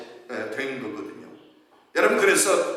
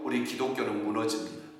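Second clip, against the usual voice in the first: ordinary talk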